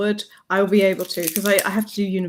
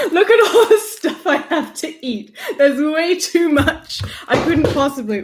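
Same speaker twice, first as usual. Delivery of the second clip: laughing; 7 semitones higher, 4 dB louder than usual. Real, not acted. Laughing at the pile of food.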